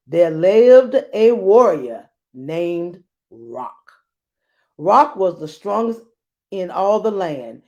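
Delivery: grand fairy-tale reading voice; storybook narration. Acted. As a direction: read it like an epic legend. Reads story to class.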